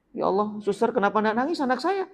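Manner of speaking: a hint of a fretting new mother; worried mother. Mother voiced asking the nurse.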